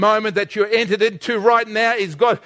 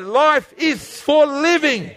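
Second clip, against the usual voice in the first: slow, raised-pitch rallying cry; like you mean it; 5 semitones higher, slower than usual. Shown as a demonstration. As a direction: declare it slowly with conviction. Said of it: "say it like you mean it".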